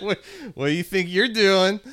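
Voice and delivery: playful alarmed what are you doing; alarmed protest. Retold or imitated. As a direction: recoil in shocked surprise. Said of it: "And you're like".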